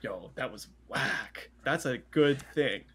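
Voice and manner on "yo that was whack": slow, impressed "whack" meant as praise; slow hyped-up praise, "Yo, that was whack"; approving. Shown as a demonstration. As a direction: say "whack" like it's the best compliment. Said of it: "But if I go".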